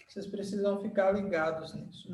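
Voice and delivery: normal talking voice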